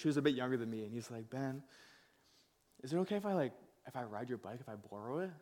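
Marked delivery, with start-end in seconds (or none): 1.3-5.3 lightly voiced young kid with broken English, asking softly; a hint of a shy immigrant boy asking to borrow. Little boy asks Ben to borrow his bike, mildly voiced softly. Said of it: "like, some broken English".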